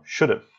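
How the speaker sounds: "should have" merged into "should've"; linked, reduced "should've" for "should have"; native contracted form. Shown as a demonstration. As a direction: say "should have" as one word, no h, weak second vowel. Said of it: "eles falam".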